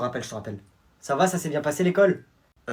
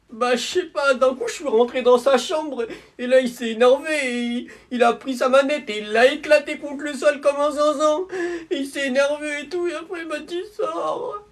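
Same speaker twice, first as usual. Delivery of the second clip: whiny tattling; 10 semitones higher, 4 dB louder than usual. Acted. Little kid complains to mum.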